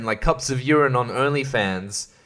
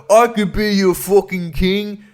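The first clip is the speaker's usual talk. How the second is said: hammed-up proclamation, pitched up and slow; would-be king; 6 semitones higher than usual. Unlikely heir after everyone dies, mock-proud proclamation.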